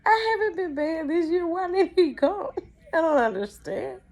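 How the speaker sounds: high, loud mock sobbing; crying child. Child protesting to brother, hammed-up crying. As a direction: wail it like a heartbroken little kid, hammed up. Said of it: "I'm crying".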